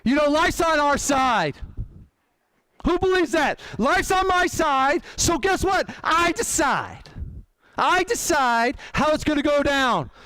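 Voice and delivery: booming motivational speech. Acted. Preaching to the room.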